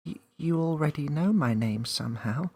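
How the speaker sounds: put-on high little girl voice; unsure girl; Millie guessing. A narrator's reading.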